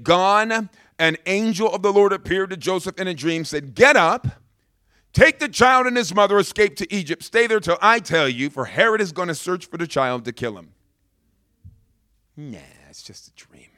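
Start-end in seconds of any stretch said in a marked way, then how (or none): 3.7-10.7 louder, higher preached proclamation, settling at the end; urgent command. Acted. Angel warning Joseph in a dream, urgent command. 12.3-13.7 very low, whispery brush-off; dismissive murmur. Acted. Dreamer dismissing the angel's warning, dismissive murmur.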